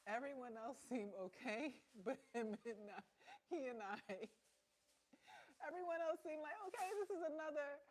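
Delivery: high, loud mocking voice of the others; the others' careless tone, hammed up; mock breezy indifference. Mocking others' calm reaction.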